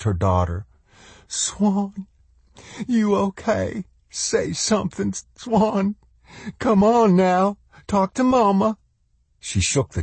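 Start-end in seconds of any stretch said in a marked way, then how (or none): 1.3-8.8 put-on high, urgent pleading with a child; anxious mother. A narrator's reading. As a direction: coax a dazed daughter in a high voice. Mother urges dazed child to speak.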